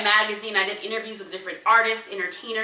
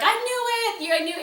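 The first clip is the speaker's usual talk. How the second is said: a much higher, thrilled cry of I knew it; excited cheer; 14 semitones higher than usual. Retold or imitated. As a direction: squeal it like friends cheering the big news. Associates cheer her news.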